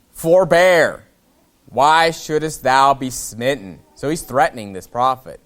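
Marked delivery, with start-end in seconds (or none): 0.1-1.0 louder, higher stern command. Acted. King warning the prophet off, louder stern command. 1.7-3.8 slow, weighty threat; slow menacing reading. Acted. King threatening the prophet, slow weighty reading. Reads scripture, slowed.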